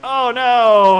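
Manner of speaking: commentator's alarmed groan of "oh no"; dismayed outburst. Real, not acted. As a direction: call out the mishap in dismay. Racer goes off track again.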